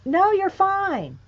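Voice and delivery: normal talking voice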